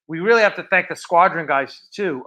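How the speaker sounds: ordinary delivery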